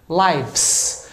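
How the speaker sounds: "lives" with F turned to V before the S; natural plural sound. Shown as a demonstration. Teacher shows plural after singular, natural VS ending. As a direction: let the end of "lives" slide into VS. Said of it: "nada mais é do que o som natural".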